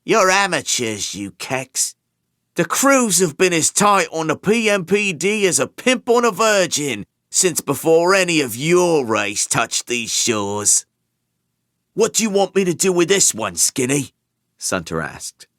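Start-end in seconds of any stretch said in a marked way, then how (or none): 0.0-10.8 high, loud scornful jeer; sneering contempt. Acted. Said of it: "snorted and added". 11.9-14.1 fast, high, loud thug voice; quick question to the boss; eager henchman. Acted.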